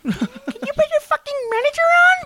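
very high squeaky demand; hammed-up tantrum in a tiny, piercing voice; mock angry child. Mocking her demand for the manager, squeaky indignation.

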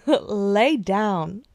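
sassy friend voice. Retold or imitated. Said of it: "I would be like".

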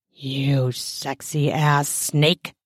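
usual speaking voice

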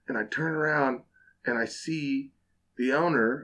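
ordinary talk, nothing marked

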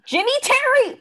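high excited squeal. Real, not acted. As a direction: squeal it happily. Shipping excitement.

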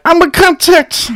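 very high squeaky mock voice. Mocking haters threatening his podcast, very high and squeaky. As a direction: squeal it like a tattletale. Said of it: "People are like".

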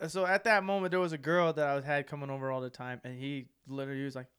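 normal talking voice

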